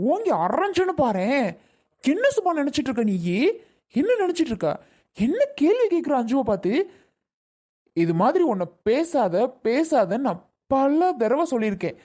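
high-pitched, exasperated telling-off; angry scolding. Acted. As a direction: scold them as if ready to slap. Acted scolding, threatening a slap. (கோபமா அதட்டித் திட்டுவது)

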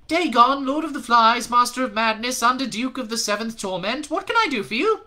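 very high, loud grand titles; demon lord. A narrator's reading. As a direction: announce yourself like a hellish noble.

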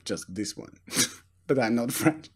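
put-on French accent. Host greets ladies and gentlemen in a mock French accent. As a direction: greet the audience in mock French, then sheepishly trail down. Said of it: "so I will speak English with a, a French accent".

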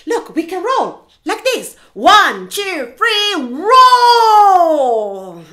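loud, high demo ending in a long "roll"; eager hippo shout. Acted. Hippo showing flamingo how to roll, loud and excited. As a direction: shout the count and launch into it. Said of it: "So Hippo says".

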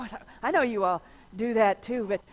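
usual speaking voice

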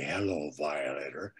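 low, gruff movie-hero growl; deep tough-guy film line; young Clint Eastwood. Retold or imitated. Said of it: "It was a young Clint".

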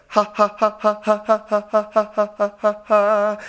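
bouncy staccato "Ha" from the belly; diaphragm laugh exercise. Shown as a demonstration. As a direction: pulse each "Ha" off the diaphragm. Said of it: "that little bounce feeling".

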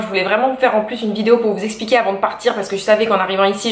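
rapid, rushing through the words; fast talking. Real, not acted. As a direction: talk it out quickly, in a hurry. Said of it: "Je parle très, très vite".

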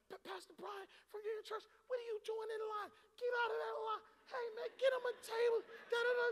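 high, excited mock manager voice; restaurant manager. Pastor imitating a restaurant manager pulling him from line, high. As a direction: play the eager manager calling him out. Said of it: "be like".

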